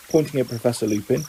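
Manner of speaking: ordinary delivery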